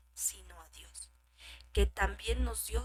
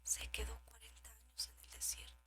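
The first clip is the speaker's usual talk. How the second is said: dramatic whisper; 12 dB quieter than usual. Acted. Preacher drops to whisper.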